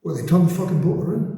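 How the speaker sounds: Glasgow voice. Retold or imitated. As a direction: bellow it like an exasperated Glaswegian. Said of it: "this Glasgow voice going".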